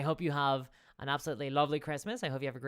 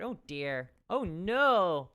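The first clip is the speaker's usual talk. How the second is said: ordinary talk, nothing marked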